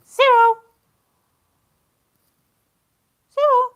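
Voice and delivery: loud, high call across the house; shouting the dog's name. Real, not acted. Calling the dog Cyril away from the guinea pigs, loud shout. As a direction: yell for the dog to stop. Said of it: "I'm gonna shout at him".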